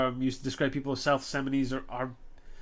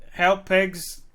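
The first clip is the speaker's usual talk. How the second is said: loud, raised-pitch cry for help; yelling for help; 5 semitones higher, 7 dB louder than usual. Acted. Child yelling to the police, loud cry for help. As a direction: yell it out as a child. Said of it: "the sound gets louder as the child yells".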